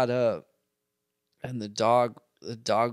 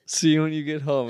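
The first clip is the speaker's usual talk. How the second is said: high, loud, laughing and crying; tripping on acid; 7 semitones higher, 10 dB louder than usual. Retold or imitated. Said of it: "you were just like".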